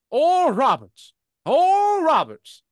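high, slow calling out a name; a hint of a mysterious calling voice; calling voice of God. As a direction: call his name from beyond. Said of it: "I heard a voice".

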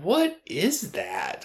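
high, drawn-out quiet disbelief; incredulous, slow and high-pitched; baffled disbelief. Retold or imitated. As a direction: stretch it out high, puzzled by the sight. Acted baffled reaction to odd hat.

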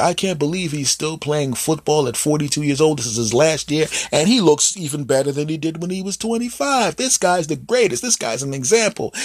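gushing commentator. Acted. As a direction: rave like a TV analyst. Gushing announcer.

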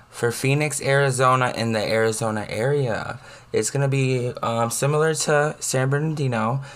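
upbeat weather forecast read, slowing on figures; weather report. Acted.

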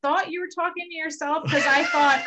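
puzzled performer. Retold or imitated.

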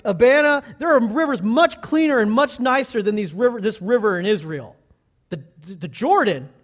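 loud, high-pitched incredulous protest; indignant disbelief. Acted. Proud Naaman objecting to washing in the Jordan, loudly indignant. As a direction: balk at it in proud outrage.

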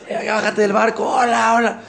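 high, laughing hellos from the dock; family calling out happily to the returning father; cheerful greeting. Acted. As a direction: wave him off the boat, laughing. Family greets him from shore.

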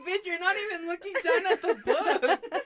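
high, loud laughing jab; retold outburst with a laugh; mock call-out. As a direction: exclaim it in amused disbelief. Retells calling out fake reading.

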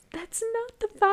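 high, sing-song repeat; mocking high voice.

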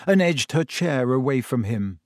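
usual speaking voice

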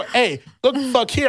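retold angry shout-down; heated comeback. His heated retort, retold.

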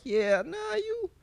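high mocking girl's voice; squeaky dismissive woman; high girly voice. Mimics woman turning him down.

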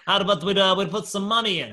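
put-on Russian accent. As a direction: imitate a Russian proposing a bet. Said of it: "this accent is getting worse".

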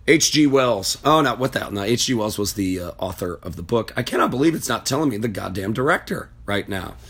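ordinary delivery

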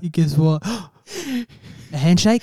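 gasping, high incredulous question; shocked disbelief. Real, not acted. Co-host hearing the story's twist, gasping in disbelief. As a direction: gasp and ask it in disbelief.

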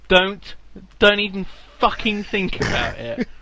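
loud, high warning; sharp warning. Real, not acted. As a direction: warn them off forcefully, word by word. Warns co-host off a joke.

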